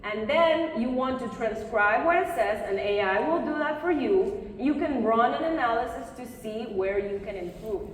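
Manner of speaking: ordinary delivery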